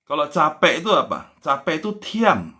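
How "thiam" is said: "tiam" with an aspirated t, like "thiam"; high, breath-released "tiam". Shown as a demonstration. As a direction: push a puff of air after the t of "tiam". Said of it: "ada hanya".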